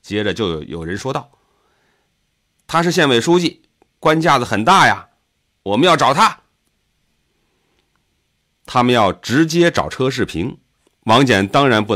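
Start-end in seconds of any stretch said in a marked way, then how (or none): 2.7-6.4 high-pitched, rousing call; raised, heated accusation; agitator in a crowd. A narrator's reading. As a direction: stir the crowd against an official. Someone in crowd stirs up others.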